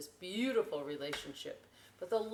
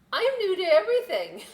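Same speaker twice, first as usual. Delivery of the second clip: high, loud and fast with a laugh; cheery; 13 semitones higher, 13 dB louder, faster than usual. Acted. Little boat answering the lighthouse, cheery and laughing. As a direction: bubble over with cheer. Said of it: "the boat said cheerily".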